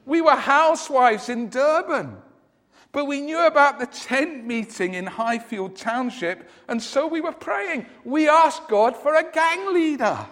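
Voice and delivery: a hint of an old lady; lightly voiced old lady. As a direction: explain gently as an old housewife. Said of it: "the lady replied".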